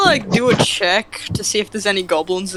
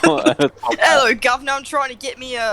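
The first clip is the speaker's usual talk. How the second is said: a lightly put-on Cockney voice, quick on the second half; bad British accent; 4 semitones higher than usual. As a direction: try a British accent and fail, fast. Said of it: "That's not British".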